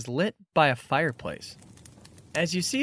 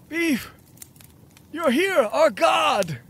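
worshipping cultist; 13 semitones higher, 4 dB louder, slower than usual. Acted. GM voices worshipping cult people.

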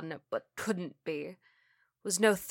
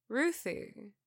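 soft, raised-pitch soothing call; calm reassurance; 4 semitones higher, 5 dB quieter than usual. Acted. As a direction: gently settle a panicked loved one. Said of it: "with a calm reassurance".

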